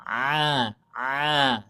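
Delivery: "A" dengan tenggorokan tengah disempitkan; ain sound. Shown as a demonstration. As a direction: tekan suara dari tenggorokan. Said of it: "tenggorokan tengah nih, disempitkan". (ain dari tenggorokan tengah)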